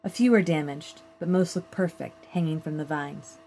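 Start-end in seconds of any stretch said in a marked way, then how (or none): none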